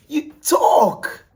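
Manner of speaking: high, loud command; shout. Acted. Vlogger yelling at viewers to talk, loud and high. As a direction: bark the order to talk. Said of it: "commanding listeners".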